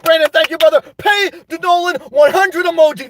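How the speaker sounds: high, shouty hype-man announcement; super chat hype. Real, not acted. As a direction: call out donations with big, stretched-out energy. Hyping up super chats.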